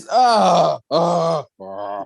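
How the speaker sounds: repeated fed-up groans that run out of steam; exasperated groan. Real, not acted. Host is worn out by the couple, exasperated groaning. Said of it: "They are exhausting".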